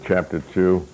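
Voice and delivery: ordinary delivery, nothing marked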